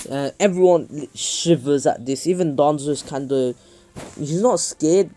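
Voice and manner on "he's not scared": plain reading voice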